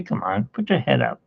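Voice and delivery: usual speaking voice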